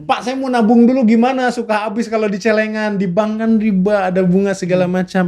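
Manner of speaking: high-pitched, fretting customer query; imagined customer. Acted. Customer asks him how to save money, imagined customer voice. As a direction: pose it high, like someone raising objections.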